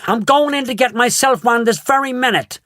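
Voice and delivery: high bratty declaration; defiant brat. A narrator's reading. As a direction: announce it defiantly. Veruca storming off defiantly.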